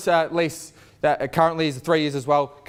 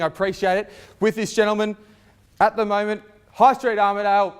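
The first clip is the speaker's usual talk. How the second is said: rally the bidders, full voice; auctioneer; 5 semitones higher than usual. Acted. Calling for bids at auction.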